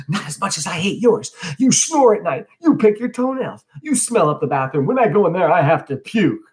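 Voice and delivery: put-on high-pitched, heated kid-brother comeback; screaming retort. A narrator's reading. Younger brother trading insults, mildly screamed retort. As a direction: fire insults back in a high, worked-up voice. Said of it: "Eugene screaming".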